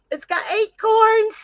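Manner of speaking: delighted squeal over a new crown; giddy squeal. Real, not acted.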